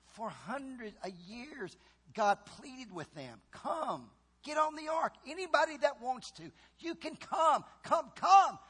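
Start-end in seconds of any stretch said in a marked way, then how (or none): 3.5-8.6 pleading. Acted. God pleading with the people.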